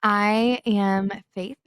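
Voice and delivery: ordinary delivery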